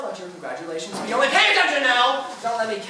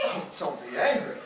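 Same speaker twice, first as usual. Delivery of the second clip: lower, softer mocking echo with a laugh; 7 semitones lower, 4 dB quieter than usual. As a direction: throw their plea back at them, sneering. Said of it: "you say".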